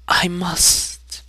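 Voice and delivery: high, soft, weeping plea; tearful plea. A narrator's reading. Mother tearfully begging her brother to raise her son, softly weeping. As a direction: beg through tears, quietly. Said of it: "she only wept and said".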